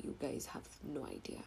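soft unvoiced aside; hushed confiding aside; whisper. Real, not acted. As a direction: whisper it like a secret. Aside to listeners.

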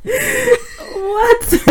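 high, incredulous what through laughter; laughing what. Real, not acted. Friend reacts in shock to the kindergarten question, laughing disbelief. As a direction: burst out in disbelief, half laughing.

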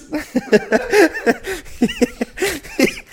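high-pitched burst of laughter; a squealing real laugh. Real, not acted. Friend cracking up at his own roast, high laughing.

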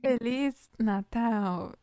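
slow, careful language-teacher model; teaching demo. Shown as a demonstration. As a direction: model the Portuguese phrase slowly for learners. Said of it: "So let's say it again".

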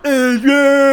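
high, loud lopsided slurred cry; mock bellow from one side of the mouth; Sylvester Stallone. Mocking Stallone's drooping left side, loud slurred impression. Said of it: "Sylvester Stallone, he looks like he's had a fucking stroke".

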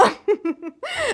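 another scornful chuckle; brief derisive laugh; short mocking laugh. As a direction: laugh at him again. She mocks his confession.

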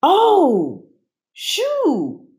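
high delighted repeat; little girl voice. A narrator's reading. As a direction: echo it like a happy toddler. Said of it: "Stella repeated".

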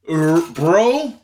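ordinary talk

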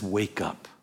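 low, soft, understated command; quiet statement. Acted. Preacher quoting the first instruction to the church, quiet statement. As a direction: say it softly and low, holding back.

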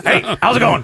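high put-on ghoul greeting, amused; Beetlejuice. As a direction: toss out a quick high character hello. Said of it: "Have you ever heard my Beetlejuice".

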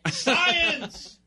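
high laughing shout; triumphant shout. Real, not acted. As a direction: cry it out in triumph. Triumphant exclamation.

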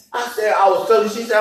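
ordinary delivery

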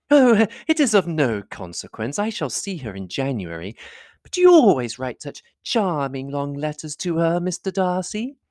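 high-pitched, fussing society lady; effusive lady admirer, pitched high; gushing flatterer. A narrator's reading. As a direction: fawn over him in a high, swooping voice. Voices Miss Bingley flattering Darcy.

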